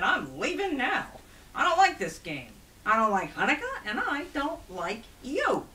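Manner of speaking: loud, high, indignant refusal; angry goblin, slowing on the final insult; huffy goblin. Acted. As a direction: storm off in a goblin's temper. Goblin angrily quits the game.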